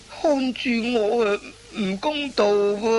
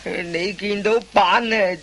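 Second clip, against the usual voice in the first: normal talking voice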